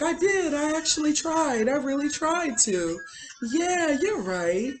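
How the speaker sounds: high-pitched mock hysterical agreement. Imitating a woman cutting her husband off, mock-hysterical. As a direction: gush fake agreement high, mocking her. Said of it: "laughing hysterically".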